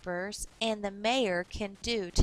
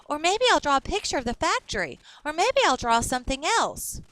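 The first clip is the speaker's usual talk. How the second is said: put-on a lightly higher, quicker girl's voice, louder; brightly voiced girl; 5 semitones higher, 5 dB louder, faster than usual. Shawnee thinking aloud about her picture, eager and quick. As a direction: bounce through the ideas like an eager kid.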